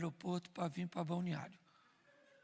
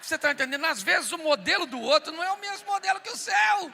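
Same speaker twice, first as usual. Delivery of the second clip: much higher, louder preaching shout; 9 semitones higher, 12 dB louder than usual. Real, not acted. Preacher shouting the lesson to the crowd. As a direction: drive the point home at full voice. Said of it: "preaching climax to audience".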